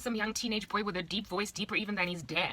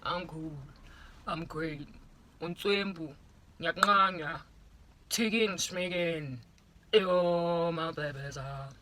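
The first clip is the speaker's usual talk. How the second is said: lightly lowered boastful schoolboy; show-off boy voice; slower than usual. Retold or imitated. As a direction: puff yourself up like a cool boy. Boy answers showing off.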